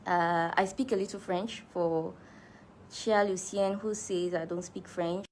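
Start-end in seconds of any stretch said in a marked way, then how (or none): none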